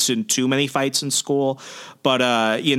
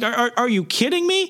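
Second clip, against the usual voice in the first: higher, stuttering mock disbelief; stammering disbelief; 11 semitones higher than usual. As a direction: stumble over the start in disbelief. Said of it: "Peter's just like".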